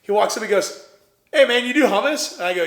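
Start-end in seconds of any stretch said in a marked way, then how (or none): 1.3-2.3 higher, louder mock of a chatty guy; eager stranger. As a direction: play the nosy guy in line, louder. Stranger approaches him in line.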